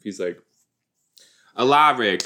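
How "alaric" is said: put-on Alaric with a loud, broad Boston accent; playful Boston accent on Alaric. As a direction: bark his name like a Boston guy. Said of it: "He should have a stronger accent".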